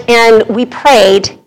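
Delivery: usual speaking voice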